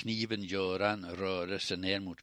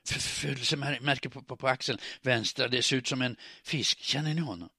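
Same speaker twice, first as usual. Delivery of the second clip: stuttered starts, higher and urgent; stumbling words; 5 semitones higher, 4 dB louder than usual. A narrator's reading. Woman describes Anders to Klara, words stumbling out. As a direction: stammer into it, then press on to the question. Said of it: "Orden kom snubblande nu".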